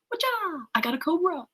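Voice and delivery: high-pitched mock karate cry; playful show-off. Playful retelling of alchemist showing off snake.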